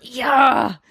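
short happy shout; excited cheer. Real, not acted. Cheering that the contest returns.

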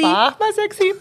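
cheeky mock command to the model; mock photographer call. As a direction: bark it like an over-eager photographer on set.

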